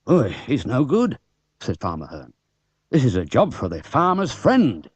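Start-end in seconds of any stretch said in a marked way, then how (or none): 0.1-1.2 a hint of a gruff country farmer; dismissive farmer, lightly played; lightly voiced farmer. Farmer Hearn voiced in story. 2.9-4.9 a hint of a gruff country farmer; dismissive farmer, lightly played; lightly voiced farmer. Farmer Hearn voiced in story.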